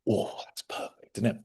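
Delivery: whispered delight at the tea; whispered after a sip. Real, not acted. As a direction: breathe it out as a whisper. Sipping tea, savouring it.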